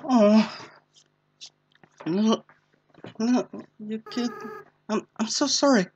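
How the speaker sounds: a hint of a tearful kid apologizing; apologetic child voice. Asriel apologizing to Frisk, a touch tearful.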